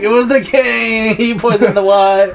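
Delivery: slow, high mock wail; dying confession. Dying brother naming the king, slow theatrical wail. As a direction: drag out the hammed-up revelation.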